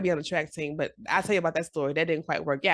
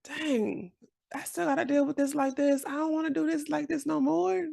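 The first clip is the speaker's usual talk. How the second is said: raised pitch, weary whine of frustration; frustrated inner voice; 9 semitones higher than usual. Retold or imitated. A woman voicing her own fed-up self, high and whiny. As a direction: complain high like you're sick of it. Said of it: "That's the part that's like".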